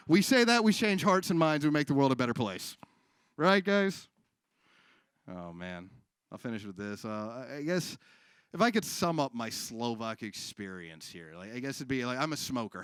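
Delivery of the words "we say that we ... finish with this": usual speaking voice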